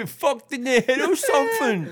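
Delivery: very high, slow, squeaky put-on voice; squeaky mock insult. Still joking back at his mate, very high and slow. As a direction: squeal it like a cartoon.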